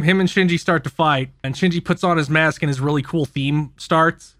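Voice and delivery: ordinary delivery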